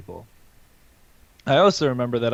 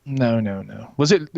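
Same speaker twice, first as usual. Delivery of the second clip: ordinary talk, nothing marked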